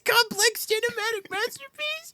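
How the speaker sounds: high whiny cartoon kid; shrill, indignant cartoon boy; Billy voice. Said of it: "quoting Billy".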